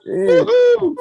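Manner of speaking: celebratory "woo-hoo", far above speaking pitch; high, loud whoop. Real, not acted. Host cheers after the laughter.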